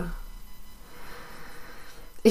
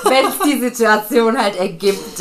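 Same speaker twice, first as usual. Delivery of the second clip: laughing; 4 semitones higher, 7 dB louder, slower than usual. Real, not acted. Cheeky admission, laughing.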